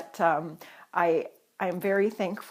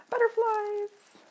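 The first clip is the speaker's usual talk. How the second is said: delighted; 14 semitones higher, slower than usual. Real, not acted. Delighted at the stickers.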